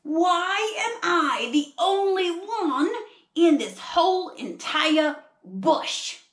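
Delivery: high, loud, drawn-out storybook protest; hammed-up koala complaint in an Australian accent; aggrieved koala. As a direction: wail the question slowly and loudly. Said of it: "So he's wondering".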